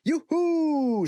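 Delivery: high squealing cheer; the girls cheering about the treasure hunt. A narrator's reading. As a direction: squeal with delight. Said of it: "sagde alle pigerne".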